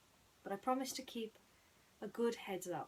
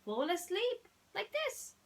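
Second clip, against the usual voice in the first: much higher squeaky mouse voice; mouse showing; 13 semitones higher, 4 dB louder than usual. Acted. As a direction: show him how it's done, cheerfully. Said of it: "pretended to snore softly".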